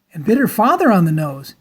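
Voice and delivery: ordinary delivery, nothing marked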